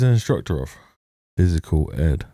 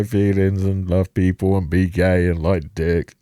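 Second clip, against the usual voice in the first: mocking loud voice; 4 semitones higher, 3 dB louder than usual. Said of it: "It's just screaming".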